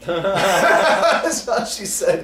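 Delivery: high, loud words through laughter; laughing. Real, not acted. Laughing at his own story.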